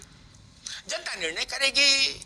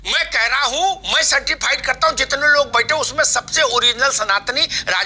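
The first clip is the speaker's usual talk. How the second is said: raised voice, much louder than usual; talking over crosstalk; 2 semitones lower, 8 dB louder than usual. Real, not acted. Moderator to squabbling panelists, loud overriding voice. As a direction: push loudly over the others to take control.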